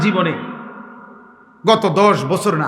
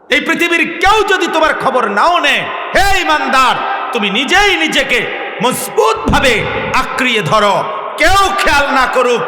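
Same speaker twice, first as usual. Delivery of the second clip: full-force preaching; 5 semitones higher than usual. Real, not acted. Preacher urging believers to hold themselves firm, impassioned exhortation. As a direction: drive it home to the whole congregation.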